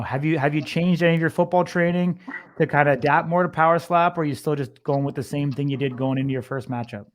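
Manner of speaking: usual speaking voice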